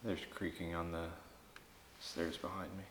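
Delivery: hushed and tense in the dark; barely above a breath, listening; nervous night vlog whisper. Real, not acted. Creaking on stairs, night hunt.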